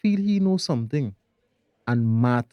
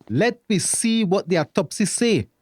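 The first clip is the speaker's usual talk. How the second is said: normal talking voice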